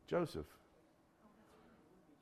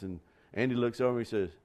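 normal talking voice

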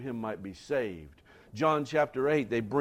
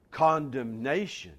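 usual speaking voice

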